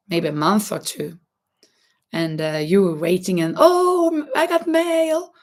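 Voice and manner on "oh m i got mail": high, thrilled mock delight; excited. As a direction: squeal with happy surprise. Retold joy at getting letters.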